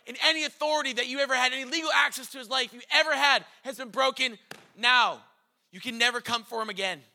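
rapid, loud, excited preaching; fast fervent preaching. Acted. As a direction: rush it out with fervor.

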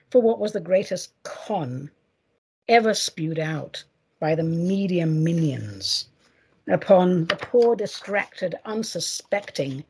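normal talking voice

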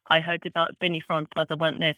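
usual speaking voice

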